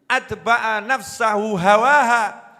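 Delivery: ordinary talk, nothing marked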